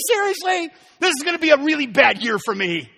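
can this really be happening; high, loud, fed-up disbelief; exasperated disbelief. Retold or imitated. Despair after terrible New Year.

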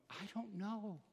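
very soft mock-tearful voice; imitating crying wife. Wife answering husband asking why she's crying, imitated tearfully. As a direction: retell it in a small, weepy hush. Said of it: "she's just crying".

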